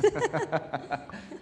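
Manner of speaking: a low, embarrassed laugh; shy laugh. Real, not acted. Thanking the pastor for the compliment, a low shy laugh.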